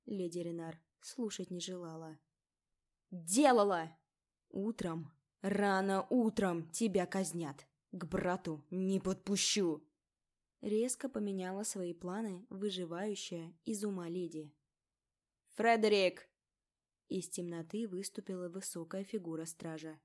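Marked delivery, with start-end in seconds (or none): 3.1-4.0 loud furious accusing yell; furious shout. A narrator's reading. Lady shrieks accusation back. 4.5-9.8 loud, furious death sentence; angry shout. A narrator's reading. Lady sentences prisoner to death. 15.6-16.3 loud summoning shout; calling out. A narrator's reading. Lady calls guard from dark.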